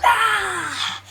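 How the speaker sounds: high startled cry; sudden high yelp; sharp cry. Acted. Opening cry of fiction.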